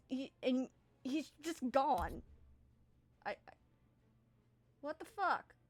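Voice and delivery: Ravine briefs the party, exasperated and loud; a timid NPC losing patience over the fleeing bandit; frustrated Ravine. Acted. Timid guide Ravine tells the party, exasperated. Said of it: "he goes".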